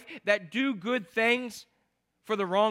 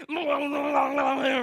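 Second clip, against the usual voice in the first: Tasmanian Devil whirl; 5 semitones higher than usual. Retold or imitated. Preacher imitating an over-eager church newcomer, Tasmanian Devil babble. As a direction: garble it like a whirling cartoon beast. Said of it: "Anybody seen Tasmanian Devil?".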